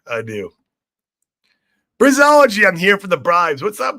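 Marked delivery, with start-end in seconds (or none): 2.0-4.0 calling out a name, high and loud; loud greeting. Real, not acted. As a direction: hail him across the room.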